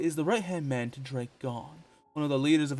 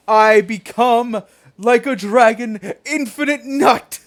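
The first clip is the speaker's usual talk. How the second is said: booming villain rant; 7 semitones higher, 12 dB louder than usual. Acted. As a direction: bellow it like a mad god. Ranting villain invoking gods.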